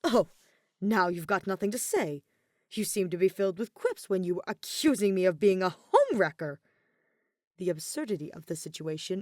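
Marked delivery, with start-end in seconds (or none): 0.0-6.6 louder, needling retort; huffy jab. A narrator's reading. Kaveh huffing at Alhaitham's silence, needling. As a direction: throw his silence back at him. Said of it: "Kaveh huffs".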